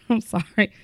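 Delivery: apologizing through a laugh; giggling, holding back laughter; trying not to laugh. Real, not acted. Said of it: "I'm trying not to laugh on this podcast".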